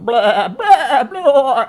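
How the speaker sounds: high, loud panicky bleats; hammed-up creature bleat; sheep-like bleating. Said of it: "sheep deer creatures are just going".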